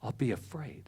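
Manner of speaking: near-whisper, very soft; hushed admission. Real, not acted. Speaker admits fear to the congregation, hushed admission. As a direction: let it drop to a whispered confession. Quietly admits being afraid.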